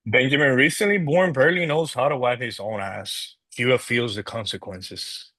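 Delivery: ordinary talk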